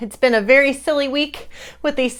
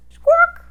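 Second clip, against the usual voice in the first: much higher, loud squawk; bird squawk; 9 dB louder than usual. Pretend. Host squawks like a hidden bird. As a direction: squawk like a parrot.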